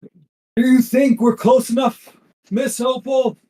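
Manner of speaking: loud, high shout over a storm; captain yelling. Acted. Captain calls to Miss Opal through the storm, yelling. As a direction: yell it out to a crewmate. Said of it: "yells out".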